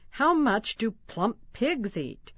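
usual speaking voice

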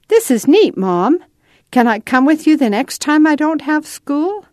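put-on a thrilled kid begging to come back; excited boy Nathan. A narrator's reading. Boy pleading with his mom to return, excited.

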